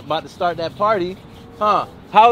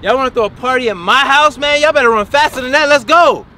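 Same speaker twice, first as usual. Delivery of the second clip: raised, loud drill-sergeant scolding; stern dad shouting, building higher; angry yelling; 5 semitones higher, 6 dB louder than usual. Acted. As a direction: holler at them to run harder. Dad yells at running kids.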